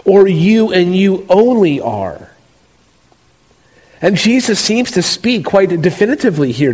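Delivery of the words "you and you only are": "You and you only are" slow, stressing each "you"; deliberate preacher's stress on "you only"; emphatic you. Shown as a demonstration. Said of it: "It's as though Jesus is saying".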